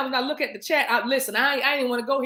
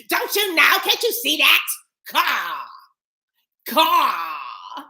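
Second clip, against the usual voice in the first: preacherly mock; 2 semitones higher, slower than usual. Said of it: "It was almost like".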